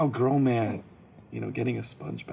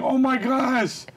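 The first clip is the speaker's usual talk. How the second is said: mock scream; 12 semitones higher, 5 dB louder than usual. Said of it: "I was screaming".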